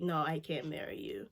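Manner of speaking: hushed, breathy whisper of a refusal; imitating her quiet refusal; whispered quote. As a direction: whisper the line as if retelling it. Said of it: "she was like".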